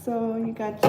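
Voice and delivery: usual speaking voice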